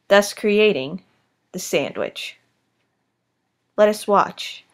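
hushed wildlife documentary narrator; nature documentary. Acted. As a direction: narrate like a nature film host.

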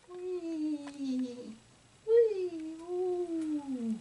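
soft, drawn-out, gliding "whee" and "ooh"; soft playful whee. Real, not acted. Painter voicing the brush stroke, softly squealing. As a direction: let out a small sliding squeal with the stroke.

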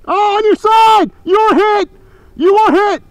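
high, loud alarmed yell; alarmed shout. Real, not acted. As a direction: yell out the hit. Shouting teammate is hit.

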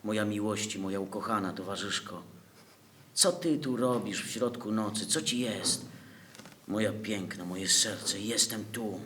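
czule i cicho, niżej; tender. Acted. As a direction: szeptem prawie, z miłością. Czułe słowa do umierającej ukochanej. (czule, ciszej do ukochanej)

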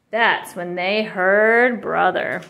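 ordinary delivery

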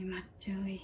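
"mặt trời" whispered, no voice; whispered repeat. Shown as a demonstration. Repeating the word sun softly.

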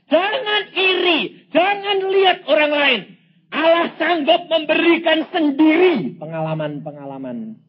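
loud, high preaching cry; forceful exhortation. Real, not acted. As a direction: hammer each command home at full force.

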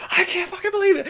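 high-pitched mock fanboy disbelief; imitated frenzied fan. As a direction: play the geek going wild. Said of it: "and he goes".

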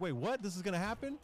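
high-pitched replay of amazed excitement; retold excited disbelief. Retelling a last-second runback to a friend, excited disbelief. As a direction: relive the disbelief, voice jumping up. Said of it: "I was so excited".

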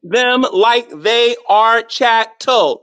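word-by-word, heavily spaced; exaggeratedly slow explanation; real slow. Real, not acted. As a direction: make each word land separately, slowly. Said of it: "say it real slow".

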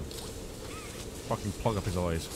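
low, slow, soft mutter; grim mutter. Real, not acted. Player quietly plotting to blind the machine, slow mutter. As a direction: growl the plan under your breath.

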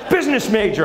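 put-on high, pleased kid voice; a son who thinks he got away with a swear; cheeky kid. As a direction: kid smug about a near swear. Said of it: "He thinks he got away with something".

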